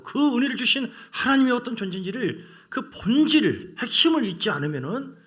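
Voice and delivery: preacher hammering the core of the gospel, loud; fervent preaching. Real, not acted. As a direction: preach it at full force. Preaching climax.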